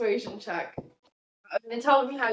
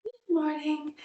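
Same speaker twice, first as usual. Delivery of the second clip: sleepy waking voice; 6 semitones higher, 4 dB quieter than usual. Acted. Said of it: "She rubs them and says".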